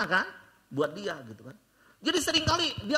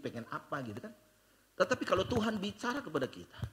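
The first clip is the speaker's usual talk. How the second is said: normal talking voice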